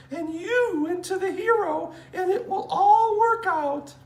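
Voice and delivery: very high sugary falsetto wife; doting wife voice. Acted. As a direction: coo over her husband.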